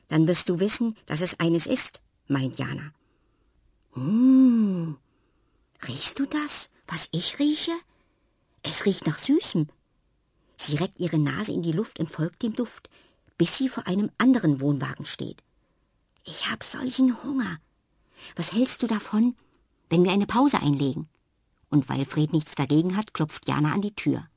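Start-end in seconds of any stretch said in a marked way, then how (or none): 3.9-9.7 put-on curious girl voice, half whispered, higher; Jana sniffing, hushed. Jana whispers to Fred about the sweet smell, curious and hushed. 16.2-21.1 higher, half whispered plea for a break; hungry girl voice. Acted. Jana suggests a pause to Fred, hungry, half whispered.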